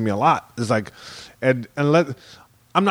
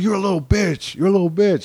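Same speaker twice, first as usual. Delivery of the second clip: higher, insistent bully imitation; mock tough, turned up; 7 semitones higher than usual. Bully performing for passing girls, mock tough turned up. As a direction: play the bully showing off harder. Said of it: "turns it up even more to look tough".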